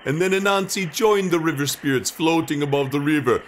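plain reading voice

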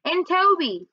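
put-on high kid-brother add-on call; mild high call. A narrator's reading. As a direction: pipe up from behind, lightly calling. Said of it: "Toby called out from behind me".

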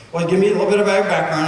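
rapid, hurried doctor's question; fast talking doctor. Retold or imitated. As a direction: rattle it off like a busy doctor in a hurry. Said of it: "she's talking kind of fast".

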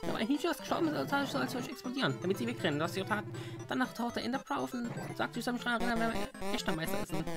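high, rapid-fire babble; frantic explanation. Acted. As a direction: rattle it off high and fast. Creeper babbles explanation to master.

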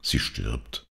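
low, subdued father's voice; lightly voiced dikegrave. A narrator's reading. Father tells child of the dying woman, low and subdued. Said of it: "sagte der Deichgraf".

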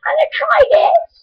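pushed up very high, almost a squeal; squeaky high voice. Real, not acted. Kid playing with a voice-changing drink, squeaky high. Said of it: "see if I can change it".

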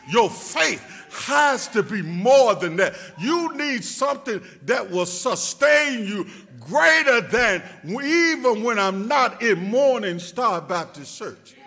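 loud preacher's cry; preaching at full force. Acted. Preacher lifting the church, loud and rising. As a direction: lift it to the rafters.

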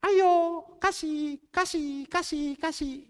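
high, pressing call to give; urgent urging. Retold or imitated. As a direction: push him on, urgent and high. Holy Spirit urging him at dawn. (desakan mendesak dengan nada tinggi)

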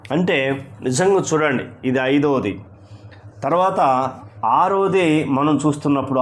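ordinary talk, nothing marked

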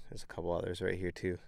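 ordinary talk

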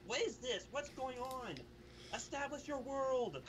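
rising into high-pitched exasperation; exasperated confusion. Retold or imitated. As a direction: replay your baffled demand to the film. Acting out his exasperation at film.

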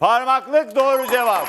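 loud, high celebratory shout; coşkulu doğru cevap anonsu. Real, not acted. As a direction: shout the winning answer to the studio. Host calls out correct answer. (coşkuyla, yüksek sesle bağırarak)

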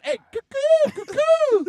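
loud high squawk of a cuckoo; cuckoo, high and loud like a bird; high-pitched bird call. Retold or imitated. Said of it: "she's like, trying to signal all her girls".

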